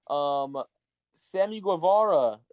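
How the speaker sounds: ordinary talk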